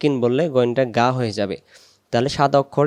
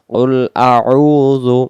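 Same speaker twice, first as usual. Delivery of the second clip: very slow, louder model recitation; Quran recitation; 5 dB louder, much slower than usual. Shown as a demonstration. Teacher modelling the phrase for learners, slow recitation. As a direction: hold the long vowel in আউযু.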